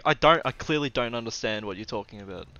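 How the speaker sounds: loud, emphatic protest; mock-exasperated, punched words; retold exasperation. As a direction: spell out your confusion, fed up. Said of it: "I'm like".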